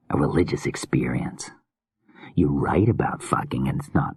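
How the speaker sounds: hushed, unsettling madman musing; menacing whispery killer. Acted. Creepy killer muses on art.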